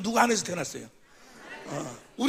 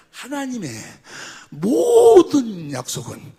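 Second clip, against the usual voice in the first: slow emphatic phrase; 3 semitones higher, 8 dB louder, much slower than usual. Real, not acted. Preacher stretches the phrase.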